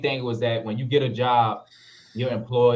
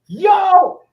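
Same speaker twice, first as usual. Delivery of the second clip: a high, loud yo of recognition; happy yell across the store; excited yell back; 10 dB louder than usual. Retold or imitated. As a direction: shout back to a friend you just spotted. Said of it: "He was like".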